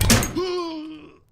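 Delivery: sharp loud gasp of fright; startled gasp. Real, not acted. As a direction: gasp as something pops. Chemical pops suddenly.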